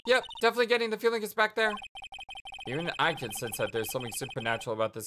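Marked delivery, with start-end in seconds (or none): 0.1-1.7 put-on perky girl voice. Perky sidekick voice.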